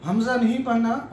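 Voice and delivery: ordinary talk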